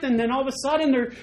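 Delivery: ordinary talk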